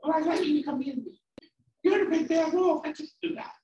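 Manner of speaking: very high, squeaky pig squeal; little pig. Acted. Pig refusing the wolf, frantic high squeal. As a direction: squeal it out loudly in a tiny pig voice. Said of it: "And the pig says".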